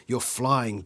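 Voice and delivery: ordinary delivery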